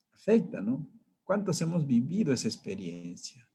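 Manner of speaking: ordinary talk, nothing marked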